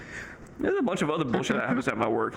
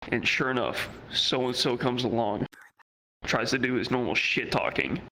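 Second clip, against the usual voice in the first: ordinary delivery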